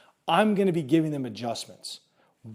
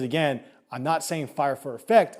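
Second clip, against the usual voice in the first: normal talking voice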